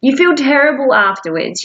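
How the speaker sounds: normal talking voice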